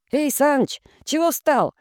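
high shouted call. A narrator's reading. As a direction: yell across to the driver. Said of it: "крикнул кто-то".

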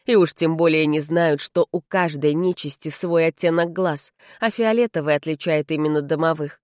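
plain reading voice

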